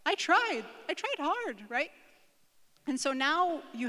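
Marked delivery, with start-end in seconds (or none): 0.1-1.6 high, loud, wounded insistence; protesting child. Acted. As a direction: plead your case like an upset schoolgirl. Acted child protesting to a parent.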